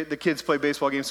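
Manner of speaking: usual speaking voice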